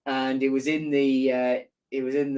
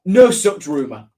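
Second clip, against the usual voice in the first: firm denial; 8 dB louder than usual. Real, not acted. Shuts down viewer's rumour.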